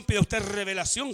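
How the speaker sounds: ordinary talk, nothing marked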